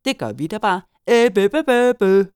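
high, loud mocking babble; taunting children. A narrator's reading. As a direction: jeer like kids in a playground. Children tease the gnome.

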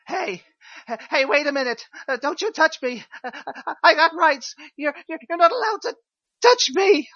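high, panicky inmate, stammering on you're; cornered prisoner squealing about his rights; frightened inmate. Acted. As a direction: stutter out the protest in a high scared voice. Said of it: "let's try that one more time".

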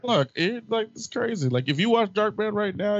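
excited. Real, not acted. Defends the movie excitedly.

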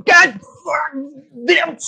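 high pained yelps between curses; high yelping curses as if getting shocked; shocked cursing yelps. Retold or imitated. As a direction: yelp each curse like you're being zapped. Imitating shocked cursing kid.

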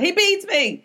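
sudden high-pitched mock outcry; mock yell. Comic imagining the First Lady on the stand, mock yell. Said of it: "and she just yelled".

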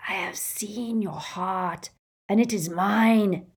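a lightly voiced evil thing in a box; hissing locket voice. The Horcrux hisses at Ron, slow and eerie. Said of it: "a voice hissed".

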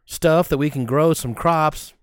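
slow, stretched southern drawl; hammed-up, leisurely southern drawl; long southern drawl. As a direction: draw every word out like a southern gentleman. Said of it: "southern drawl".

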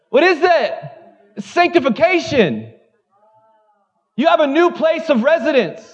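high, sustained preacher's cry; full-force preaching. Real, not acted. Pastor declaring new identity to the congregation, impassioned preaching. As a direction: preach it with rising urgency, pitched high.

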